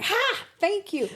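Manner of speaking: high, loud mock "ha!"; triumphant mom. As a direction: crow it in a high pitch. Mom's triumphant retort retold.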